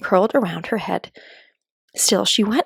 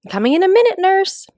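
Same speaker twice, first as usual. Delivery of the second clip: put-on child calling back; 11 semitones higher, 4 dB louder than usual. Alice answers imagined nurse.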